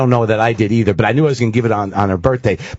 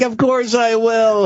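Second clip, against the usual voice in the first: put-on high, slow, dreamy girlish yes; imitating fiancee; 10 semitones higher, much slower than usual. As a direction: draw it out, much higher, like her. Fiancee accepting proposal, imitated.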